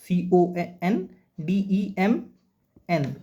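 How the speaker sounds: letter by letter, slow; spelling out slowly. Shown as a demonstration. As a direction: spell each letter out clearly. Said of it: "N silent है यहाँ पे". (धीरे-धीरे अक्षर बोलना)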